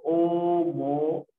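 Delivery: "homogéneo" stretched syllable by syllable; slow, measured "homogéneo"; slow sounding-out. Shown as a demonstration. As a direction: draw out each part of "homogéneo". Teacher sounds out the term.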